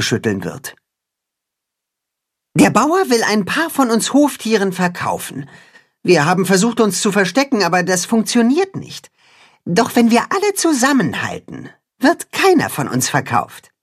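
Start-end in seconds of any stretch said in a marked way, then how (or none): 2.6-13.7 high, earnest rallying voice; high earnest cat-hen rallying the farm animals. Acted. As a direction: unite them, high and determined.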